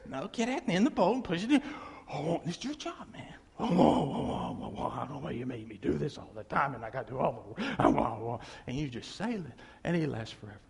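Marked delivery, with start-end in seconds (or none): 2.1-8.4 low, soft hammed-up moaning; mock groaning husband. Preacher voices the husband complaining while he works. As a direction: grumble like a put-upon husband.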